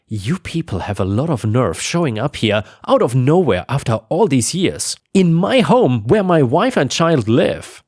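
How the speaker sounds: usual speaking voice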